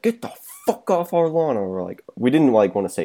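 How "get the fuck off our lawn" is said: high, put-on drunk yell at the kids; drunk neighbor yelling. Drunk man yelling at teens on his lawn, mock yell. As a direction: bark it like a wasted guy on his porch. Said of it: "and he's like".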